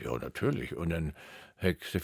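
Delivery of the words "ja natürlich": much lower, much softer, casual; offhand reply. Retold or imitated. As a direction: toss it off low and quiet. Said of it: "Und dann sag ich".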